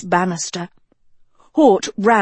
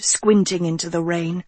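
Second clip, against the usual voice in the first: ordinary delivery, nothing marked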